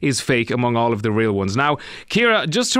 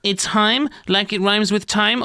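mock smug, higher-pitched know-it-all correction; smug corrector; 7 semitones higher, slower than usual. Host mimicking listeners who corrected him, mock smug. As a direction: imitate self-important pedants, pitched up. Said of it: "smug, self-important people who have corrected me".

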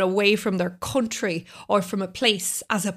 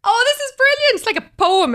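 excited; 13 semitones higher, 5 dB louder than usual. Real, not acted. Delighted praise for child's sentence.

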